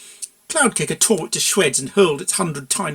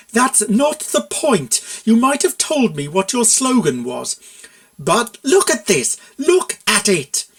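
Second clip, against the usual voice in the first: slow, loud, higher outrage; exasperated anger; 6 semitones higher, 5 dB louder, slower than usual. A narrator's reading. Mare shoving the poster at the consultant, slow loud outrage. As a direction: spell out each word in disbelief.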